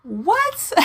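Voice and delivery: high, drawn-out shocked what; mock shock, pitched high; stunned what. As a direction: let the disbelief hang. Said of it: "And I'm like".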